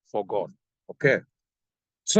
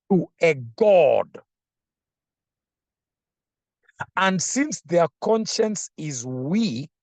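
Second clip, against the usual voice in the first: slow, lifted reading of the verse; scripture reading; 4 semitones higher, slower than usual. Acted. Preacher reading a verse to the congregation, slow and lifted. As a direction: read the verse slowly and deliberately.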